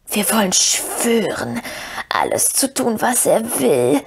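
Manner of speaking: slow, whispery creature plea; pleading Gollum. A narrator's reading. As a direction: protest devotion in a drawn-out rasp. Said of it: "beteuerte Gollum".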